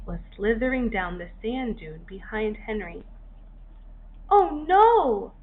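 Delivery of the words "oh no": higher and louder frightened gasp of words; scared oh no. A narrator's reading. Henry spotting the snake behind him, scared. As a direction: let the fear jump out. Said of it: "thought Henry".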